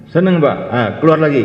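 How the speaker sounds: high, loud cheerful reply; eager student. Acted. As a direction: answer the teacher keenly.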